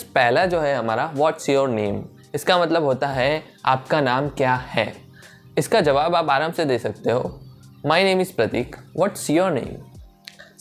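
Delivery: ordinary talk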